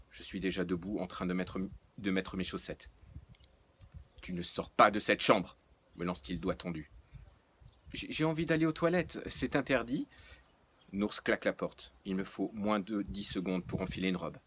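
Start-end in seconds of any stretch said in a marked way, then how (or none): 4.2-5.6 loud, stern command; angry parent forbidding her to leave; stern order. Acted. Nours orders her to stay. 7.9-10.1 high, loud whiny challenge; cheeky protest. Acted.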